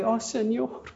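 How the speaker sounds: high, pleading cry to the Lord; a hammed-up weeping plea; retold tearful prayer. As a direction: beg for help as the weeping student did. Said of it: "Yo lloraba".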